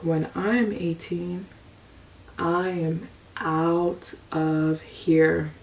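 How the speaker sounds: soft, slow, private resolve; a beaten kid silently swearing to leave; quiet vow. Retold or imitated. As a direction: murmur it to yourself like a secret promise. Inner vow as a punished child.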